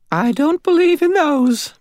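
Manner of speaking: high, slow landlady voice. A narrator's reading. Landlady answering her rescuer, high and slow. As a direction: insist as a devout, grateful landlady.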